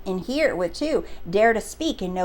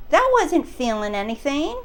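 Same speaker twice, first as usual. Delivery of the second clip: usual speaking voice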